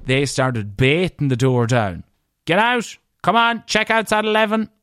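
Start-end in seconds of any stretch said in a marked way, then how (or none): 2.4-4.7 high-pitched mock yell; mock calling out. Hotel staff beating the door down at checkout, mock calling out. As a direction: holler it high, as through a door.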